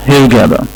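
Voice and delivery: "هيرجادا" with a hard g and no Arabic غ; English pronunciation. Shown as a demonstration. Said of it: "وبالتالي بننطق مدينة الغردقة هيرجادا". (نطق "هيرجادا" بالإنجليزي، من غير صوت الغين)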